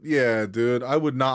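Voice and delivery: normal talking voice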